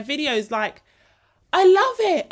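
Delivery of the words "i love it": high, loud burst of joy; high-pitched happy outburst; gushing delight. Real, not acted. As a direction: gush it out, really thrilled. Gushing over being copied.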